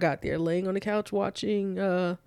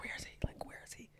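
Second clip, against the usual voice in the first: urgent unvoiced whisper; hushed asking; 19 dB quieter, much faster than usual. Daughter arrives at mom's looking for dad, hushed whisper. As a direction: whisper the question under the breath.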